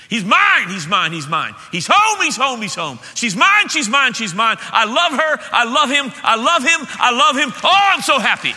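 high, loud, overjoyed cries of welcome; joyful shout. Acted. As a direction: shout it out like a father whose child came home. God welcoming prodigal home, shouting joy.

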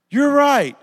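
loud, high acted revelation; amazed realisation. New convert after praying, loudly amazed. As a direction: burst out as the passenger finding hope.

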